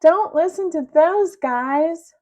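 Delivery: slow, loud, high bragging voice; boastful Melvin. Acted. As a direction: puff up like a smug kid.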